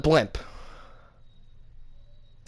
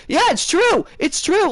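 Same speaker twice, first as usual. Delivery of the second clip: fans agreeing; 13 semitones higher, slower than usual. Retold or imitated. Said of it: "You're like".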